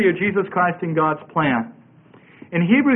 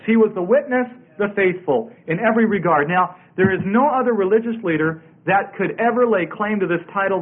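usual speaking voice

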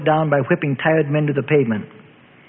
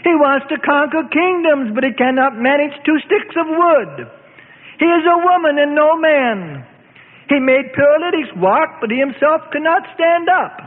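high sneering voice; mocking priest; 11 semitones higher than usual. A narrator's reading. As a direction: taunt him with contempt. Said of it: "the chief priest mocked".

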